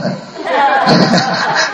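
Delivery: high-pitched burst of giggling; helpless little run of laughter; teasing laugh. Real, not acted. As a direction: crack up at your own joke. Laughs after teasing the monk.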